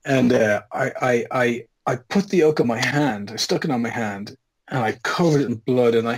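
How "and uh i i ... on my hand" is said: ordinary talk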